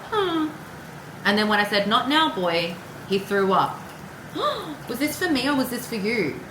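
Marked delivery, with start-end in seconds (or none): 0.1-0.5 high little whining hum like a dog; dog whine imitation. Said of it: "'cause he did that little". 3.9-4.8 sudden sharp startled gasp. Real, not acted. Startled by something mid-reading.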